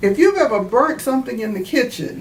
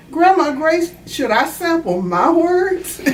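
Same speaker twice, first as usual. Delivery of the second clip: put-on higher, eager little-girl voice asking grandma; a young girl asking earnestly, pitched up; child's question; 8 semitones higher than usual. Granddaughter asks Grandma Grace, in a high eager child voice.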